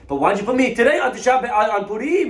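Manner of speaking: high, fast, loud complaint; outraged protest. Acted. Jailed shopkeeper demands reason from rabbi, outraged.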